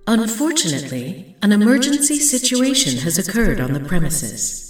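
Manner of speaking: empty lab's loudspeaker addressing fleeing mice, announcer voice; facility announcement. A narrator's reading. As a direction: read it like a calm recorded emergency message over speakers. Recorded woman's voice over speakers.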